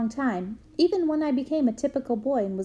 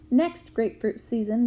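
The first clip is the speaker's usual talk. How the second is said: ordinary delivery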